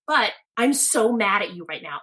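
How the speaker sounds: ordinary talk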